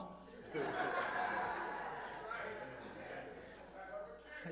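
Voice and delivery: long airy laughter; laughing it off, breathless; breathy laugh. Real, not acted. Laugh after praising the Lord.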